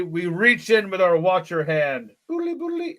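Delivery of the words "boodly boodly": silly singsong. Pretend. Reaching into book silly.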